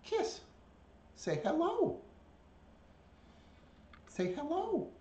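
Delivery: very high-pitched bird coaxing; pet-talk voice. Real, not acted. Owner prompting a bird to copy, high pet-talk.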